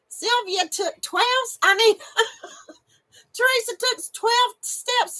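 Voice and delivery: high, laughter breaking into the sentence; laughing through words. Real, not acted. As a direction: try to speak while cracking up. Cracks up mid-sentence with happy news.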